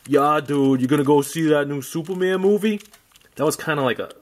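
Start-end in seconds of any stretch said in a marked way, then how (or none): 0.0-2.8 put-on Boston local asking a buddy, Massachusetts accent; casual Massachusetts accent; Lynn Massachusetts accent. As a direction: talk like a dude from Lynn, Massachusetts. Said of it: "almost a New York accent".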